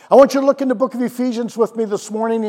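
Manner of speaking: ordinary delivery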